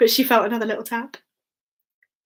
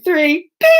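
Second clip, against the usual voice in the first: counted-in bing noise; ping it like a microwave; 3 semitones higher, 4 dB louder than usual. Shown as a demonstration. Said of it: "One, two".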